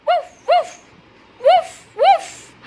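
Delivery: high, loud playful woofs; dog barks. Shown as a demonstration. Teacher barking like the dog in the tractor, high and loud. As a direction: bark like a little dog.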